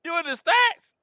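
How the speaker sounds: high, mocking question; teasing disbelief. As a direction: needle them about being statisticians. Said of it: "I'm like".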